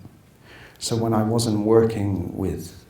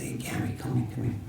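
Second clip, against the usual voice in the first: Joe Pesci impression; 8 dB quieter, faster than usual. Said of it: "Do you wanna hear Joe Pesci?".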